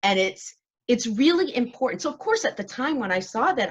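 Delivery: ordinary talk, nothing marked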